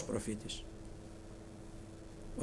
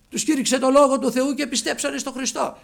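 preaching at full force; 9 semitones higher, 9 dB louder than usual. Acted. Sermon emphasis.

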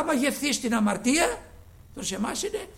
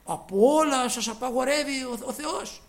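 mock objector; 5 semitones higher, 5 dB louder than usual. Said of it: "έρχονται και άνθρωποι σε εμάς και μας λένε".

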